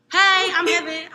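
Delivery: acted-out eager introduction; bright greeting. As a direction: call out a high, loud hello. Cheerful walk-in introduction at station.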